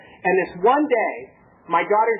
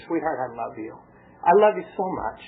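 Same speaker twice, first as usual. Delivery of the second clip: loving; 7 semitones lower than usual. Retold or imitated. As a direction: speak gently down to a hurting child. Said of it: "I talked to her with love".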